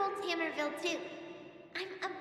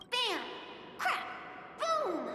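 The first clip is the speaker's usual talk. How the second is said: punchy, higher comic-book impact words; excited fight noises; 5 semitones higher, much slower than usual. Acted. Child imagines battling Svarog, excited fight noises. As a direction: act out each blow as a separate burst.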